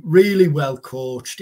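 ordinary talk

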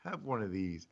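soft, low, coaxing honey; hushed, tender wife soothing her grumpy husband. Pretend. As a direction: coo it like offering a treat. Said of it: "I would be like".

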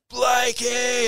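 high, bright call of a name from afar; calling back. Retold or imitated. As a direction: shout the name back happily. Friend calls back across road.

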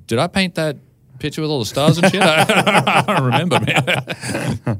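high, laughing, dopey artist; a spaced-out painter who forgot his own work; Van Gogh. Retold or imitated. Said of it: "Van Gogh going".

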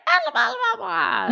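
very high cartoonish squeaky babble; high-pitched nonsense vocal; squeaky backup vocal. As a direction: imitate a silly squeaky backing singer. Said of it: "a squeaky backup vocal".

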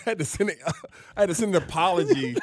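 high, laughing through the words; laughing recollection. Real, not acted. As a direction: let the laugh break into it. Laughs recalling his apology video.

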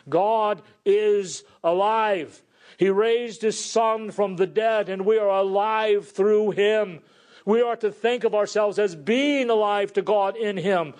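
preaching. Acted. Pastor proclaiming new life to the congregation, fervently. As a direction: lift the church up with joy.

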